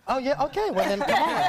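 high and loud, laughing through the words; playful welcome while laughing. Real, not acted. Laughs at guest joining camp.

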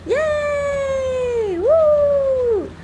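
very high excited whoop; cheering. Real, not acted. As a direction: cheer for the graduate. Cheering for graduate.

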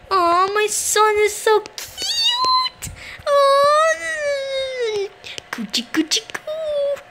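high, drawn-out gushing; mock baby cooing. Player fussing over a pretend son, hammed-up cooing. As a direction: coo over a baby in exaggerated squeals.

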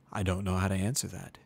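ordinary delivery, nothing marked

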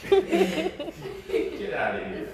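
slow, drawn out through laughter; laughing disbelief. Real, not acted. Father laughing at his daughter's joke, drawn out. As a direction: stretch it out while cracking up.

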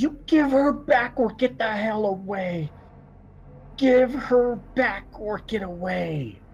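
high, furious yell, the second line slow; yelling man. Acted. Man in the cabin yells at the posse to return her, high furious yelling. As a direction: demand her back, yelling high through the door. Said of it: "he's just yelling from the inside".

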